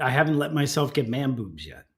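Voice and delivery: normal talking voice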